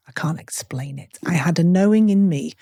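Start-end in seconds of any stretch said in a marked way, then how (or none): 0.1-1.2 hushed, breathy whisper; whispered aside. Real, not acted. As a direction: drop to a whisper. Quiet aside while confessing.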